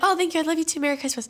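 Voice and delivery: high, softening mock-sweet reply; sweet mom. Mom answering the aunt's insult, mock sweet. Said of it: "my mom's like".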